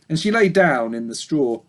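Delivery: usual speaking voice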